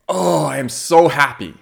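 acted-out burst of joy; gushing gratitude. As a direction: gush with happy relief, leaning into it. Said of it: "take that as gratefulness".

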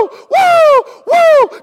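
high whooping screams; screaming woo. Pretend. Believers before God's glory, screaming high whoops. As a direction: whoop at the top of your voice. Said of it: "if we scream out".

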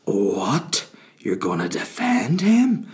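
imagine a listener protesting, drawn out and aghast; incredulous objector. Acted. Listeners objecting before hearing him out, incredulous. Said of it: "Hear me out before you go".

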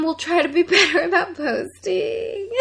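laughing high through the words; giggling while promising. Real, not acted. Laughing sign-off.

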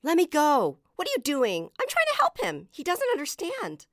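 put-on high, loud, indignant child; struggling protest. A narrator's reading. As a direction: protest while fighting a grip.

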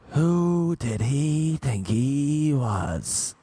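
high, drawn-out indignant imitation; mock outrage. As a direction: stretch every word in mock offence. Acted outburst of offended date.

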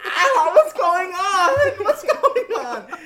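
high, loud, drawn-out wail; laughing, stretched-out shriek of panic; mock panicked screaming. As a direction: scream it in hammed-up confusion. Acts out panicking maced classmates.

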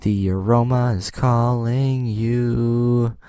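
slow ad-voice slogan; sing-song ad read, belted at the end; commercial jingle. Retold or imitated. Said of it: "I can nail their slogan".

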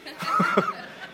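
a short high burst of laughter. Real, not acted. Preacher laughs at his own garbled quote to the congregation. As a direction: laugh at your own joke.

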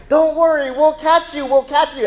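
loud, high mock reassurance; hammed-up parents promising it's safe; reassuring parents. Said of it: "what if the parents were like".

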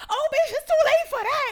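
high, loud outraged yell; screaming at the TV. Retold or imitated. Viewer yelling at the catfish reveal, screaming. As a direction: yell it in shrieking disbelief. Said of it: "I was in this house screaming like".

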